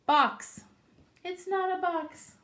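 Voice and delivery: high, loud insistent rabbit; exasperated rabbit. Acted. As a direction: rabbit stamping his foot. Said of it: "And he says".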